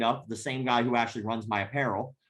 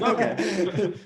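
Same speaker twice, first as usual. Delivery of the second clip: laughing mock delight; 6 semitones higher, 5 dB louder than usual.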